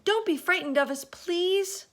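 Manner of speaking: fast, high, loud reassurance; calling out. A narrator's reading. James calls down to reassure the men, high and loud. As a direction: call down to calm them. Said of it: "James called out".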